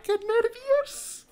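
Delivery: very high, slow squeal of nerves. Pretend. The bride squealing before the ceremony, high and drawn out.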